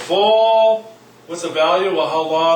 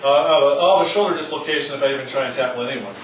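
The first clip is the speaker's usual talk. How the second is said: ordinary delivery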